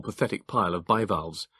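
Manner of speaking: plain reading voice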